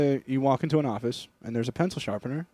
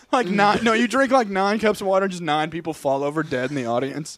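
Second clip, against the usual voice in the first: ordinary talk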